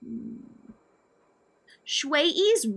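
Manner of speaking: ordinary delivery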